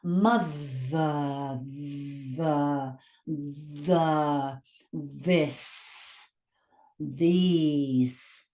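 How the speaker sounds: low, isolated mother, the, this, these with lengthened buzzing th; voiced th. Shown as a demonstration. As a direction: vibrate the th in the, this, these. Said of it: "it's a sounded consonant".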